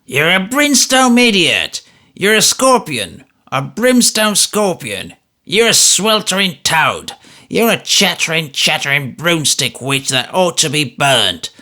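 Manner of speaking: put-on high, loud, breathless old man insults; gasping furious old man. A narrator's reading. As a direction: spit out each insult in a rage. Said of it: "gasps the old man".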